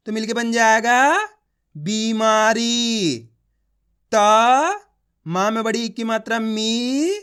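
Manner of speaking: long held syllables, rising on open vowels, then slow words like दीपक and मछली; drawn-out reading drill; letter-by-letter demo. Shown as a demonstration. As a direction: sound out दी, पा, क and blend them. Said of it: "एक अक्षर का उच्चारण कर रहा हूँ".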